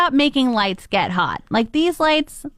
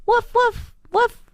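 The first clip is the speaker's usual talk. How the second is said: high, half-hearted barking; a limp, high-pitched bark; dog barks; 11 semitones higher, slower than usual. Acted. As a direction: woof without heart. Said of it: "A dog".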